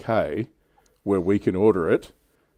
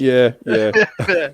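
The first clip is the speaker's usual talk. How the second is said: laughing; 5 semitones higher, 7 dB louder than usual. Real, not acted. Laughing it off after teasing.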